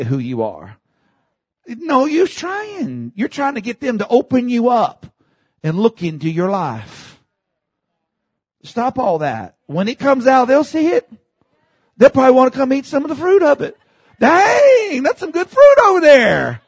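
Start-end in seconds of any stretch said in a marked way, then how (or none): 1.7-13.8 high, urgent pulpit delivery; raised-voice exhortation to the congregation; fervent preaching. Real, not acted. Preaches at rising force. 14.2-16.6 loud, high cry of mock admiration; big, hammed-up impressed holler; acted amazement. Acts out onlookers admiring the fruit.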